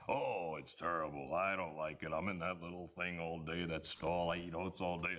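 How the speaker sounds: a hint of a grumbling horse; talking horse. Ventriloquist makes the horse complain to the farmer, lightly voiced. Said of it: "throws his voice to make the horse say".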